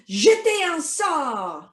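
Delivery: high, loud, drawn-out incantation; casting a spell. Pretend. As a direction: throw the spell out and let "sort" fall. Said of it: "cast a spell".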